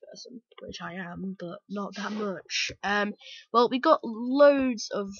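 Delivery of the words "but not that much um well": ordinary talk, nothing marked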